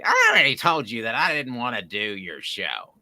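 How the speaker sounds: lightly voiced irritated old man; a touch of curmudgeon snapping back; Andy Rooney impression. Rooney interrupting the host at Radio Row, mild grumpy mimicry. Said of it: "my impression, for the record, is spot on".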